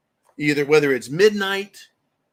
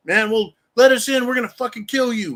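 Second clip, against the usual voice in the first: higher, louder thug demanding entry; mock intruder threat; 6 semitones higher, 5 dB louder than usual. Intruder threatening homeowner at the door, louder mock threat. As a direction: bark it like a home invader.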